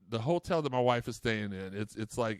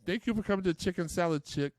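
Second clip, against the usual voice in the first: high-pitched, badly faked Minnesota accent; put-on Minnesota accent, pitched up; horrible Minnesota accent; 7 semitones higher than usual. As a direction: greet customers in a hammy Minnesota accent. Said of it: "I do a horrible Minnesota accent".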